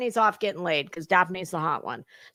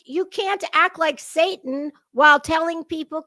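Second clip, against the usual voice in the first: usual speaking voice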